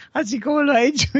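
a touch of the impressed stranger; mock woman. Stranger marvelling at the walker's dog, lightly high.

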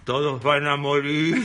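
low, slow ghost voice with a laugh; slow spooky spirit, half laughing; glitchy giggling ghost whisper. Retold or imitated. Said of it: "una voz de espíritu que le hablaba".

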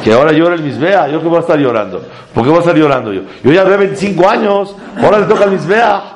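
husband's retort. Retold or imitated. The husband answering friends about crying altar, mock exasperated. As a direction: toss it off like the weary husband.

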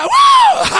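high shouted wow; preacher's ecstatic yelp; exultant wow. Real, not acted. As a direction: cry out in pure delight. Exultant cry mid-sermon.

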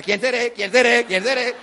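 loud mock-panicked repetition; identity crisis. Youngster with two names wonders who he is, loud mock panic. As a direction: hammer the question in comic despair. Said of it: "tienen crisis de identidad".

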